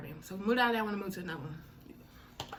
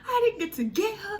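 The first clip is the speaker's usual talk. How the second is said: high tearful wail; frustrated crying to her sister, high; 12 semitones higher, 6 dB louder than usual. Retold or imitated. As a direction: cry it out, frustrated. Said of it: "I'm crying".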